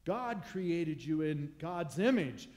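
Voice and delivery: building, loud and high, preaching hard; full-force preaching. Acted. Preacher to congregation on their worth, building loud fervor. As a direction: lift the room with rising fervor.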